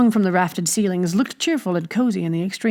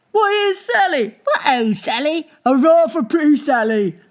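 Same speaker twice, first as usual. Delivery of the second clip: much higher, hearty tavern cheering; cheering shouts; 7 semitones higher than usual. Acted. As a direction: hail the barmaid as she enters. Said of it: "greeted with shouts and chorus".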